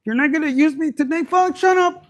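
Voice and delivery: high, loud, bratty squeak; a toy talking back, shrill and loud; sassy dildo voice. Pretend. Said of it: "your dildos are looking like".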